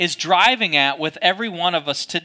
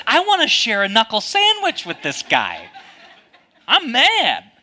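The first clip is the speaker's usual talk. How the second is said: mock angry outburst, higher pitch; hammed-up mad, pitched up; 5 semitones higher than usual. Golfer to father-in-law about the guy, mock angry. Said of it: "I, I was angry".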